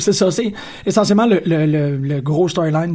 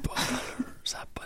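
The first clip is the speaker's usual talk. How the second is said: hushed, breathless whisper; 7 semitones higher, 17 dB quieter than usual. Real, not acted. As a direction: whisper it in awe. Awed aside about the show.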